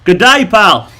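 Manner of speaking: Australian accent. Shown as a demonstration. Doing requested accents for viewers, broad Australian. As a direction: hail a pal in broad Australian.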